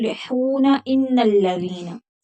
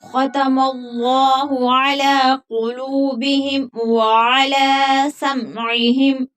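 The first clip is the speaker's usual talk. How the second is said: slow melodic tajweed chant; Quran recitation; 3 semitones higher, 3 dB louder, much slower than usual. Shown as a demonstration. Teacher reciting verses melodically for students. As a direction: stretch the vowels in a measured recitation.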